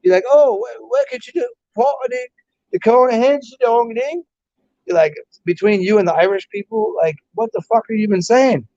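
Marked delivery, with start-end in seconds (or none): 0.3-4.2 high, garbled mock English accent; jabbery send-up of an English accent. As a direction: imitate incomprehensible English speech, pitched well up. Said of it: "you guys don't even speak English right".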